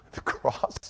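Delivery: breathy whisper. Real, not acted. Preacher naming the cross in a whisper. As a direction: whisper it reverently.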